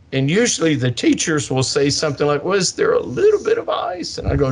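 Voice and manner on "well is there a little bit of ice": mock timid teacher. Teachers' question quoted.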